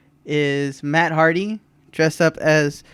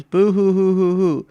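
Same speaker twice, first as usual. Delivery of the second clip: mock boo hoo; 4 semitones higher, 5 dB louder than usual. Mocking Joker crying.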